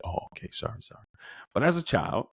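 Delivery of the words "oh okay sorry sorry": put-on whispered child's apology. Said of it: "And I said".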